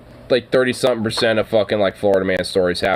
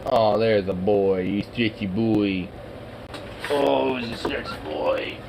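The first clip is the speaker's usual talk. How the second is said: soft, slow cooing praise; 7 dB quieter than usual. Real, not acted. Owner praising the stretchy dog, soft slow cooing. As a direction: croon to him softly and slowly.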